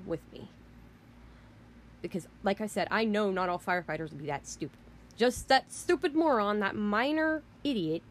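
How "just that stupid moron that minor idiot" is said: angry. Real, not acted. Angry rant at the firefighter.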